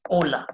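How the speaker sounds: "hola" with no H sound at the start; "hola" said as "ola"; silent H. Shown as a demonstration. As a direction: drop the H of "hola", begin on the O. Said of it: "H is silent. That's why I'm saying hola".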